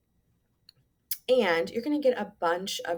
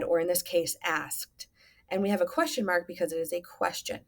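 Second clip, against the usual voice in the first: ordinary talk, nothing marked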